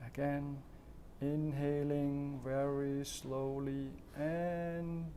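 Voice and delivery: slow, soft breathing-exercise guidance with long pauses; guided breathing. Shown as a demonstration. Coach leading viewers through slow breathing, calm and soft.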